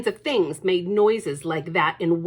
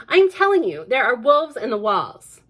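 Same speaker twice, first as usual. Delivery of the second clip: higher and louder; insistent child; 4 semitones higher, 8 dB louder than usual. Acted. Lucy insisting to her brother. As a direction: insist hard.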